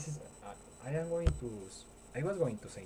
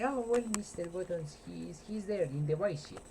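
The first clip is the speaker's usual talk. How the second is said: lightly higher nurse voice; mock nurse voice; 7 semitones higher than usual. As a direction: play the flustered nurse a bit higher. Nurse voiced in retold scene.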